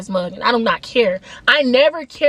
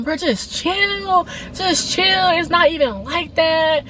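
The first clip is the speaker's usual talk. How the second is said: lightly imitated guy, higher and drawn out; placating guy; 5 semitones higher, much slower than usual. Storyteller imitating him trying to calm her, drawn-out placating. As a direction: stretch out a mock soothing plea.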